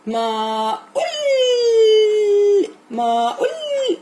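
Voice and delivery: a teacher's playful, high, drawn-out cry; playful drawn-out call. Real, not acted. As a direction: stretch the sound out high to tease the pupil. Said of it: "playful drawn-out call".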